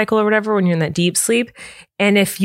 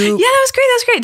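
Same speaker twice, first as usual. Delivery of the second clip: excited; faster than usual. Real, not acted. Praising the harmony, giggling.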